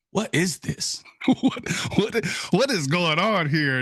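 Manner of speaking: high, incredulous questioning; astonished. Retold or imitated. Birder seeing a diving bird video, astonished. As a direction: stumble on "what" in rising disbelief. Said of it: "kinda start freaking out".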